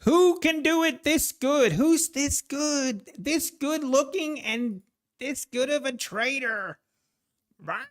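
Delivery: high mock-boastful showman; Patrick Whelan impression. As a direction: puff yourself up like a cocky star trader, drawn out. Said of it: "I was gonna do my Patrick Whelan impression".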